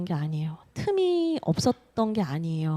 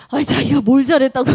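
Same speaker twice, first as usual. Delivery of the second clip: louder retold grumble at the husband; mock indignation; 3 semitones higher, 8 dB louder than usual. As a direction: replay her fuming protest. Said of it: "부글부글부글부글 했어요". (씩씩거리는 흉내)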